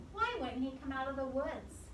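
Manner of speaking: ordinary delivery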